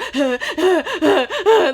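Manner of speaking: high, loud hitching sobs; mock hyperventilating cry in bursts; imitated sobbing. Sister too upset to tell her brother, imitated gasping sobs. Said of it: "when you're crying and you're so upset and you're like".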